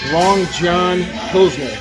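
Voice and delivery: normal talking voice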